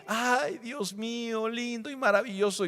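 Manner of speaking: hushed sugary praise, put on; feigned piety. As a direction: coo a sweet, insincere prayer. Mocking insincere prayer.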